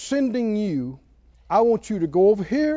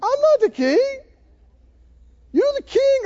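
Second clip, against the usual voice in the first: mock crying; 4 dB louder than usual. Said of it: "Kim started crying and said".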